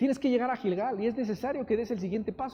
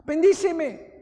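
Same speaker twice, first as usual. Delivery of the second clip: Jacob begging; 6 semitones higher, 4 dB louder, much slower than usual. Retold or imitated. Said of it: "se aferra con Dios y le dice".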